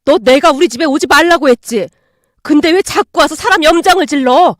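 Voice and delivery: loud angry scolding; furious scolding. Acted. Mother berating daughter-in-law, loud furious scolding. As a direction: tear into her with fury. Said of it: "소리치셨어요".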